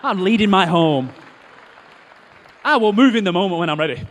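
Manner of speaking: loud, swaggering declaration; loud mock boast. Preacher jokes to congregation, loud swaggering boast. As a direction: proclaim it like a proud leader. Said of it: "joke boast".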